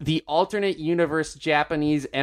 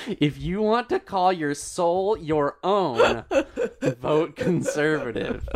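reading through giggles, slowly; slow read broken by laughter; laughing while reading; 3 semitones higher, slower than usual. Real, not acted. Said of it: "Keep going. You're doing great".

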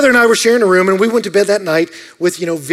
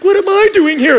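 bewildered; 11 semitones higher, 4 dB louder than usual. Retold or imitated. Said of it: "I'm like".

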